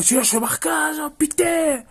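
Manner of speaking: put-on high-pitched shouty Marseille accent. Coach berating players in training, mock Marseille accent. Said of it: "je fais l'accent marseillais".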